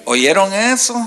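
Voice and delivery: ordinary talk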